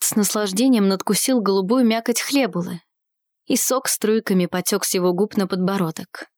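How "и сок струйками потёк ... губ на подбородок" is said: usual speaking voice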